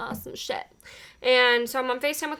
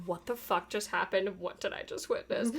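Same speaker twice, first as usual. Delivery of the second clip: stunned hush; 4 semitones lower, 12 dB quieter than usual. Retold or imitated. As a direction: whisper it in shock. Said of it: "she was like".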